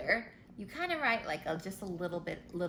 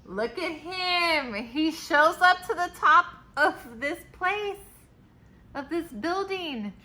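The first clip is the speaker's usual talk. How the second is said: higher and louder, delighted pointing; excited; 8 semitones higher, 10 dB louder than usual. Real, not acted. Excited about the picture.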